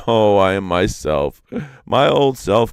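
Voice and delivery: a loud, lifted cry of relief. Acted. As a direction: shout it out in relief. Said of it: "cried Iktomi".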